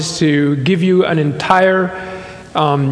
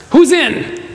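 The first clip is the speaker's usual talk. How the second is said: higher-pitched rallying question; mock recruiting call; 9 semitones higher than usual. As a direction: toss out a challenge to the crowd. Mock recruiting pitch, voicing Jesus.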